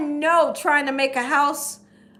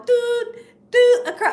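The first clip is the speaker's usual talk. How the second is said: beep sound; 8 semitones higher than usual. Retold or imitated. Imitates monitor beep.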